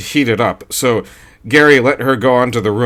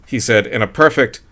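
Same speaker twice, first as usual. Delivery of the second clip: usual speaking voice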